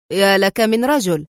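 ordinary delivery, nothing marked

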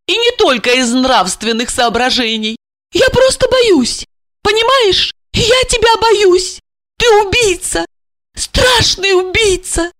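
high-pitched, distressed woman; fearful. Acted. Wife tells murderer husband she fears him, frightened. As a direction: confess dread, voice climbing high.

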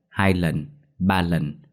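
ordinary delivery

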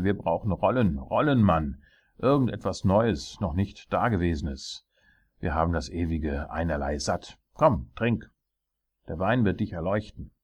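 loud, lively royal command; tyrant king. Acted. King demanding new ideas from his jester, commanding.

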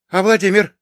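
high, loud, anxious query; strained, high-pitched woman's question; tense question. A narrator's reading. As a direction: ask urgently, braced for bad news. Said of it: "напряжённо спросила она".

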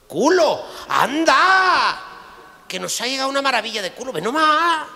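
very high, loud squeal of delight; thrilled at the new arrival; woman shrieking with mock joy; excited [name]. [name] finds a donor excitedly.

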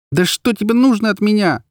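high exasperated wail; desperate, high-pitched demand. A narrator's reading. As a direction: howl it in frustration, pitched high. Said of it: "взвыла я".

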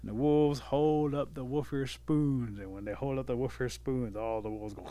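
usual speaking voice

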